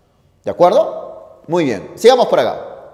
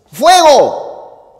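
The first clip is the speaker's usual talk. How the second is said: sudden fire alarm cry; alarmed shout; 9 semitones higher, 5 dB louder than usual. Shown as a demonstration. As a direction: yell "¡Fuego!" high and loud in alarm. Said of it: "Con ese tono de exclamación".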